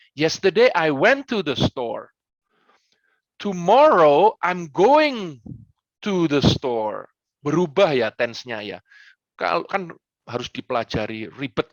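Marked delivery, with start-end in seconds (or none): 0.1-7.1 slow, with "went" and "going" stressed; careful English tenses. Shown as a demonstration. Coach showing English tense changes slowly to a pupil. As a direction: spell out each tense slowly. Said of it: "Kalau bahasa Inggris kan".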